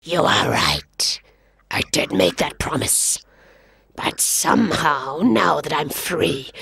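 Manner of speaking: a hungry lion murmuring low; lion purring over his prey. Acted. Lion speaks to the boy.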